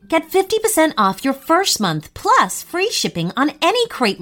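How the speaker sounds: bright, fast, loud ad read. Acted. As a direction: sell it like a sponsor spot. Sponsor ad read.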